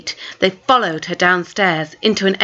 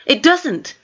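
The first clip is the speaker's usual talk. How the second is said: flustered; 8 semitones higher than usual. Acted. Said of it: "flustered and guilty".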